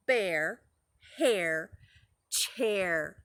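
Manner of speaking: "Bear", "hair", "chair" set apart, low in pitch; rhymes sounded out. Shown as a demonstration. Teacher confirming the rhyme for preschoolers, rhymes sounded out. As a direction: separate "Bear, hair, chair" with pauses, falling on the last. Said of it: "They all rhyme".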